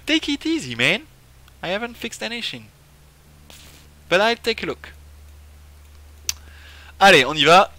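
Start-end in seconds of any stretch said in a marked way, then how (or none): 0.1-4.9 high-pitched, put-on reply voice; character reply. Player's character agrees to take a look, lightly acted high voice.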